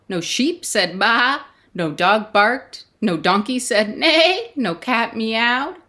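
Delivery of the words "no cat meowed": ordinary delivery, nothing marked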